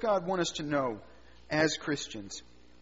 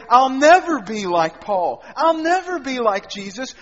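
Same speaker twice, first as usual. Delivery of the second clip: despairing cry; 4 semitones higher, 10 dB louder than usual. Acted. Believer despairs, voicing Satan's lie.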